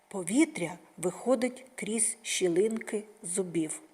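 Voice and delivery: usual speaking voice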